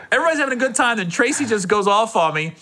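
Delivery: ordinary talk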